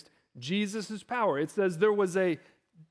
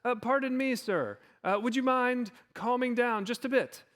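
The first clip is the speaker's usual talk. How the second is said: put-on polite, higher English accent; 5 semitones higher than usual. Meek Jesus asking the storm to calm down, genteel English accent. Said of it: "it was an English accent".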